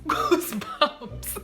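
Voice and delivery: wheezing laugh; breathless laugh on the word. Real, not acted. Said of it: "laughing at own reaction".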